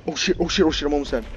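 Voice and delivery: panicked. Real, not acted. Nearly killed by monster.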